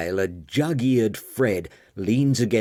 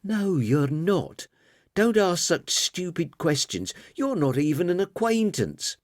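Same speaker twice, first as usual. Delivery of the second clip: put-on snippy girl; 9 semitones higher than usual. A narrator's reading. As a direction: brush him off like a cross child. Girl rebuffing the monster.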